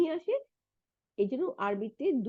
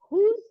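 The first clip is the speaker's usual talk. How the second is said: whose jumps high and loud on a rise; stressed whose; 10 semitones higher, 10 dB louder than usual. Shown as a demonstration. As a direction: punch out whose. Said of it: "এরপরে আসি".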